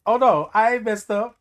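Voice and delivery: ordinary talk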